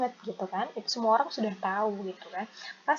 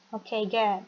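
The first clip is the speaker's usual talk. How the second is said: ordinary talk, nothing marked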